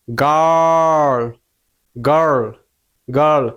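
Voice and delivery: গার্ল stretched out on a firmly held R; long held R. Shown as a demonstration. Teacher showing learners how to hold the sound, long R in গার্ল. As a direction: hold tight to the R in গার্ল, don't let go. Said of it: "একটু লম্বা করতে হবে".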